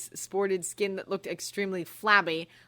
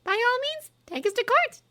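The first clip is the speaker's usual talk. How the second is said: high, cool scornful dare; contemptuous; 8 dB louder than usual. A narrator's reading. Hermione scornfully daring Umbridge to sue, high voice. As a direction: look down on her as you say it. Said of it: "looking contemptuously at Umbridge".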